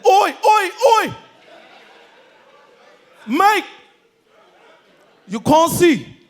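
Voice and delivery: high, loud London street shout; put-on London accent. Returnee showing off in Lagos, loud London accent. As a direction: holler like a fake South East London lad.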